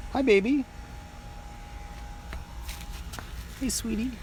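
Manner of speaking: high, soft cooing to a pet. Real, not acted. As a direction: coo gently at the cat. Greeting the cat.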